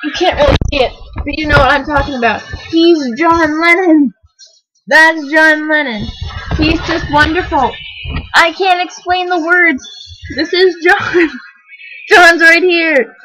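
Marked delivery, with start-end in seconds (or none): 2.7-13.2 loud, emotional gushing near tears; overwhelmed gushing. Real, not acted. Fan gushing to viewers over his photo, near tears. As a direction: swoon over him, voice cracking.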